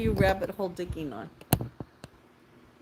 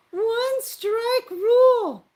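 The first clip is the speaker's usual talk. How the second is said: drawn-out proclamation; 13 semitones higher, 9 dB louder, much slower than usual. Pretend. Stretched emphatic rule.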